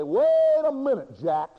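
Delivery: drawn-out high call, devil stopping Jack; a preacher's slow, loud mock devil; devil's challenge. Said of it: "and the devil's gonna say".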